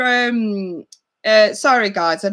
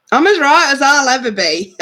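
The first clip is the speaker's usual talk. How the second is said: higher and louder, through a laugh; 6 semitones higher, 5 dB louder than usual. Real, not acted. Streamer answers a viewer's how-are-you, laughing. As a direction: laugh it off wryly.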